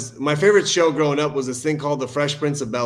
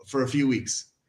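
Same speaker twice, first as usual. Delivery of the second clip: normal talking voice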